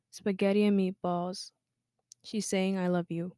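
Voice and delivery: poem reading. Acted. Reciting her poem about her mother, spoken-word style. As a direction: read it like a spoken-word piece, savouring each dish.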